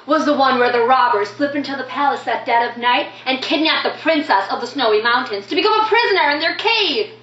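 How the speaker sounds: excited, quick storytelling in a lilting voice; lively, theatrical poem recital; animated child narrator. Acted. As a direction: recount a favourite game with childlike eagerness.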